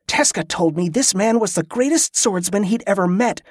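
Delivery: ordinary delivery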